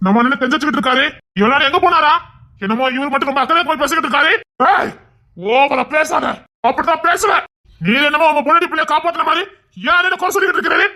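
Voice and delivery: high, heated scolding; indignant quarrelling. Acted. Heated quarrel defending the brother.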